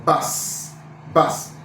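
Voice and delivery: BAS with an open, unrounded vowel; spread lips. Shown as a demonstration. Teacher showing letter U, BAS with spread lips. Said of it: "expected to spread your lips".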